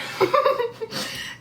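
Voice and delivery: high-pitched giggle; nervous laugh. Real, not acted. Laughs at her own scary story.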